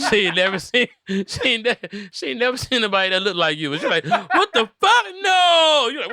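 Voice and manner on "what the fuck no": very high, loud, drawn-out freaked-out yelp; panicked shriek. Pretend. Girl on the plane shrieking at the seatmate, high panicked yell. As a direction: scream in horror at the stranger beside you.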